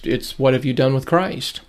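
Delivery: normal talking voice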